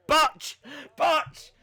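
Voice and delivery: loud, high one-word shouts; loud botch call. Real, not acted. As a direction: shout out each blunder as it happens. Calling out a wrestling botch.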